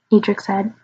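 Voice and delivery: ordinary delivery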